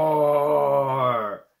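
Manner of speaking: long, loud, sustained roar; dinosaur roar. Shown as a demonstration. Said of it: "Fortissimo, really loud".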